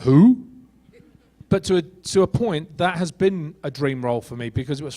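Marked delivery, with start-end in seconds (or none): 0.0-0.4 loud, puzzled one-word question; surprised who. Acted. Actor imagining being offered an unknown role, baffled. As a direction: blurt it out, baffled. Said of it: "I would be like".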